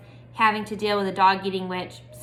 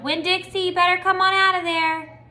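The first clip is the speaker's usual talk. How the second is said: loud, higher call over the gate; hollered call; 8 semitones higher, 4 dB louder than usual. A narrator's reading. Opal hollering for her dog at the witch's gate. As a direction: yell into the overgrown yard. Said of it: "went up to the gate and hollered".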